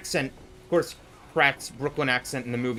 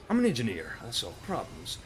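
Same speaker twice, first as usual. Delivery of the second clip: low, softer put-on mercenary voice; Engineer; 6 semitones lower, 6 dB quieter than usual. Streamer doing rapid-fire impressions, low Engineer voice. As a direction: drop it low and let it fall away. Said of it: "Here are my shitty impressions".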